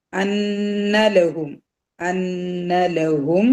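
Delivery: very slow അന്നലഹും, nn hummed long; അന്നലഹും with a drawn-out nasal hum; two-count ghunnah. Shown as a demonstration. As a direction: hold the nn in അന്നലഹും for a nasal beat. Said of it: "രണ്ട് count മണിക്കുക".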